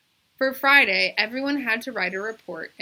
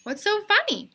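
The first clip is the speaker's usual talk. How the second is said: much higher, louder, genuinely baffled; puzzled girl; 13 semitones higher, 8 dB louder than usual. A narrator's reading. Bebe asking the laughing class, high and puzzled. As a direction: ask it wide-eyed and confused.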